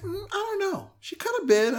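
tease, drawing it out; coy friend. Retold or imitated. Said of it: "She's like".

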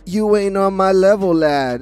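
mock Australian taunt with a light Aussie twang; Aussie accent. Mocking Grayson Waller taunting Cody, in a light Aussie accent. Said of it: "with his Aussie accent".

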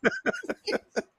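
very high wheezing laugh; real uncontrolled laughter; squealing laugh. Real, not acted. Still laughing.

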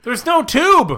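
loud, high protest; exasperated shout. Real, not acted. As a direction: shout it in disbelief. Protesting the joke.

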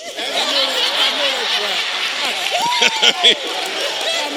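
a long, high laugh bursting out; high burst of laughter. Real, not acted. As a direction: laugh high and long at your own story. Laughs at his own story.

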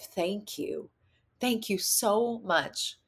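soft, tender thanks, slowing down; hushed sincerity; heartfelt gratitude. Retold or imitated. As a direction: let the thanks sink in gently. Retold heartfelt thanks repeated.

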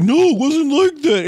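high, slow whiny protest; mock whiny denial. Moochie denying it to laughing kids, whiny high protest. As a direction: whine it like a caught kid.

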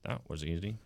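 soft, half-hearted Easy button imitation. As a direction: badly imitate a talking desk button, quietly. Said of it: "That was a terrible impression of that voice".

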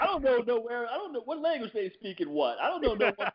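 high, frantic mock stammer; a cornered guest blurting in a high voice; imitated panicky guest. Mimicking a panicked guest grilled with hard questions. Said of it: "Say what again".

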